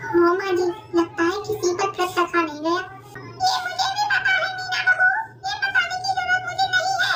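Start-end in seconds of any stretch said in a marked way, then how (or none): 3.4-7.1 high, put-on old woman's voice; squeaky elderly saas, grumbling; old mother-in-law. (ऊँची आवाज़ में बूढ़ी सास)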